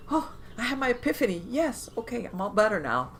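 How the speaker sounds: high, hammed-up sudden elation; mock epiphany. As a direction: act out a miraculous breakthrough. Mimics an instant miraculous cure.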